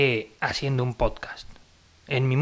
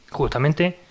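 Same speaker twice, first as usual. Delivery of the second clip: usual speaking voice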